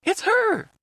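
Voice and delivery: very high, thrilled cry. A narrator's reading. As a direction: burst out with delight. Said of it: "Otacon sounded thrilled".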